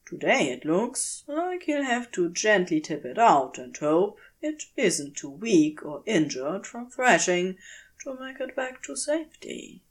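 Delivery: ordinary delivery, nothing marked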